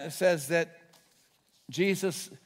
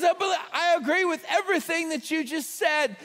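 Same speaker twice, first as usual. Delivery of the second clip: excited agreement; 9 semitones higher than usual. Retold or imitated. Curbside worker agreeing.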